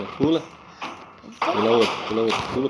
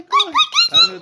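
high, loud panicked squeal; being chased; 9 dB louder than usual. Real, not acted. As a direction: shriek it in a laughing panic. Fleeing enemy in game.

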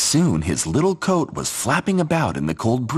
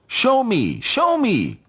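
much higher, eager plea repeated; eager scarecrow; 13 semitones higher, much slower than usual. Acted. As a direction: bounce with excitement.

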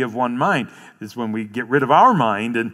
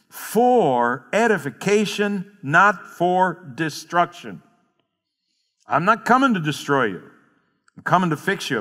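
preaching; 4 semitones higher than usual. Acted. Pastor preaching to congregation, raised and fervent. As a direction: build the congregation up with conviction.

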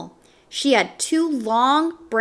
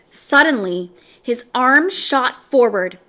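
plain reading voice

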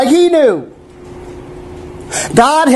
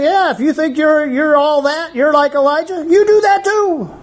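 high-pitched jeering dare, rising higher at the end; mocking taunt; 6 semitones higher than usual. Children daring Elisha to copy Elijah, mocking. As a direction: taunt him in a raised, goading voice. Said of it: "And they were saying".

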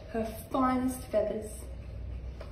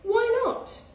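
surprised; 10 semitones higher, 4 dB louder than usual. Acted. Dingo asks innocently.

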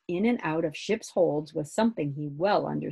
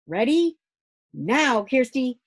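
higher, louder command call; signal call; 7 semitones higher, 8 dB louder than usual. Acted. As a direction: call the moment to act. Father gives the signal.

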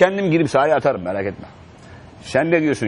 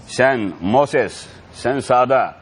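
usual speaking voice

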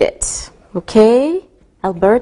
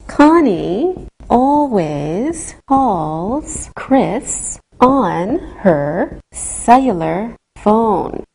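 very slow, words drawn out; slow and careful; much slower than usual. Shown as a demonstration. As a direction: spell it out slowly for the phone. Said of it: "speak slowly on the telephone".

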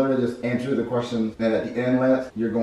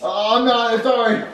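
high, loud, whiny apology; apologetic kid character; 13 semitones higher, 7 dB louder than usual. Acted. Junior scolded by the chef, apologetic. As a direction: whine out an apology.

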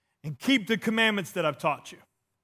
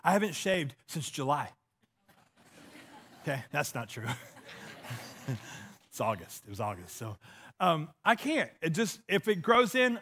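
ordinary delivery

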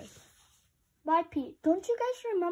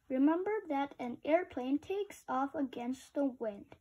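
usual speaking voice

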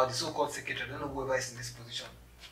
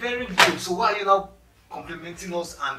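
loud, raised confrontational voice; indignant challenge; 4 semitones higher, 9 dB louder than usual. Acted. Applicant challenging a secretary over empty compliments, indignant. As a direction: pounce on the admission, loudly.

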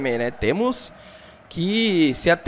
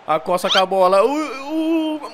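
lively football commentator calling the action; play-by-play commentary; 5 semitones higher, 5 dB louder than usual. Acted. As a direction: call the match like a TV announcer. Live match commentary in game.

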